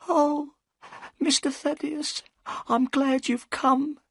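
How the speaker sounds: put-on very high, trembling old woman's voice; a scared old servant pleading; frightened housekeeper. A narrator's reading.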